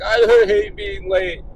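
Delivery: drawn-out mock-tearful wail; imitated crying. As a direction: mimic a daughter wailing through tears. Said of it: "My daughter's crying, like, real fucking tears".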